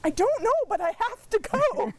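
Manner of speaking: high sing-song mock answer; stubborn reply. Answering parents who ask why, high playful mock insistence. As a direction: imitate her younger self insisting helplessly. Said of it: "my parents would say".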